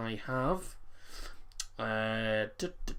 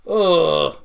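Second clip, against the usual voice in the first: loud sudden groan of discomfort; pained groan from heartburn; 4 semitones higher, 14 dB louder than usual. Real, not acted. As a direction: groan out loud at the burning in your chest. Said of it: "Got heartburn".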